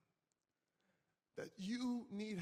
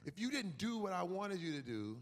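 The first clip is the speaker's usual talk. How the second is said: ordinary delivery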